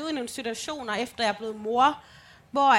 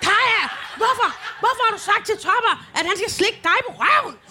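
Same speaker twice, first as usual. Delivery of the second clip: scolding mom; 9 semitones higher, 6 dB louder than usual. Retold or imitated. Mother scolding daughter.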